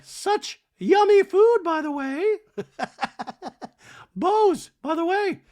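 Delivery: very high, loud, squeaky sing-song voice; hammed-up high Dorit voice; mock Dorit. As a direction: gush it like a ditzy diva. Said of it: "Dorit's like".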